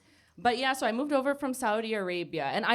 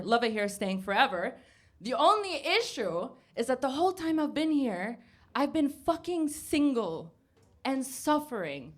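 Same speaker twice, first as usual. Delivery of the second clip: ordinary delivery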